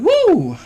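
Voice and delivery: loud, high "woo"; sudden high, loud cheer; excited whoop. Real, not acted. Whoop mid-talk after mentioning video ideas.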